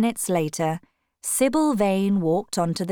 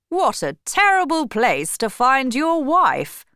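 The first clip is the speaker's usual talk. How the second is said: a lightly voiced Lord Henry, a bit higher and louder; Lord Henry's remark; 6 semitones higher, 5 dB louder than usual. Lord Henry mocking the shabby theatre to Dorian, mildly raised voice.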